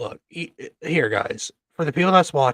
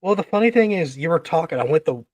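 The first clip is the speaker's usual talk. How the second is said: ordinary talk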